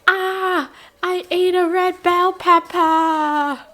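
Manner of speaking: hammed-up horrified realization; mock shock. Kid's shocked discovery.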